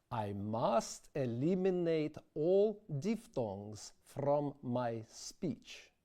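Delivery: slow English in a Russian accent, no diphthongs. Shown as a demonstration. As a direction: speak it slowly with a Russian accent. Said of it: "if I want to speak English with a Russian accent".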